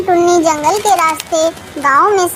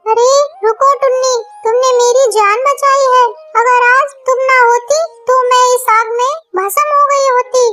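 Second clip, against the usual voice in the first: higher, otherworldly serpent woman, grateful; grateful nagin speaking to Tunni in a higher, otherworldly voice; magical snake voice; 6 semitones higher than usual. Acted. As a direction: play the nagin thanking her rescuer. Snake speaks after being rescued.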